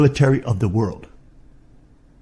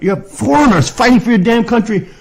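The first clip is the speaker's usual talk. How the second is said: real outraged scream, far above usual pitch; high, drawn-out angry shouting; upset screaming rant; 13 semitones higher than usual. Real, not acted. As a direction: scream it out slowly in fury. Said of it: "I should not have to get upset at people".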